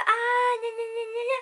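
high mocking gna gna gna; mock his syrupy babble; mocking mielleux. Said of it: "Il était mielleux".

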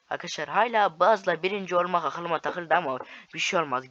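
ordinary talk

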